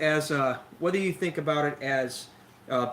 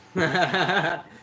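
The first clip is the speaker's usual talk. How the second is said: amused laugh; 2 semitones higher, 5 dB louder than usual. Real, not acted. Laughing at own coffee rant.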